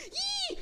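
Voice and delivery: very high panicked gasp; sharp gasp. Retold or imitated. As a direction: gasp in terror. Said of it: "elle fait une rotation".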